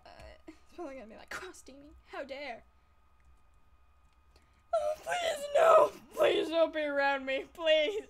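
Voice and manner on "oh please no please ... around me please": loud, high panicked begging; panicked pleading. Acted. Begging an approaching enemy player, panicked shouting. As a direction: yelp and plead as if cornered.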